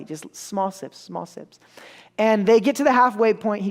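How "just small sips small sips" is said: dropping away very soft; hushed. Real, not acted. Storyteller describing the boy's tiny sips, hushed. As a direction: shrink the voice down, barely audible. Describes furtive, very slight sipping.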